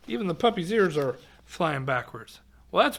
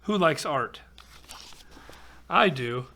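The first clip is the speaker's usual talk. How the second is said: ordinary delivery, nothing marked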